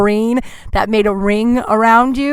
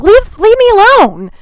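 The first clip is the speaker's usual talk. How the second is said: higher and louder, fed up; exasperated; 11 semitones higher, 5 dB louder than usual. Real, not acted. To the stranger interrupting her book, exasperated plea. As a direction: shoo him off, please leave me alone.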